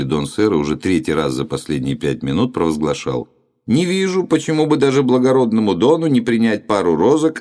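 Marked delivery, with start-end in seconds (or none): 3.7-7.4 high and loud, for all to hear; loud proclamation. Acted. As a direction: announce it loudly to the whole queue. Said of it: "громко, так чтобы все слышали".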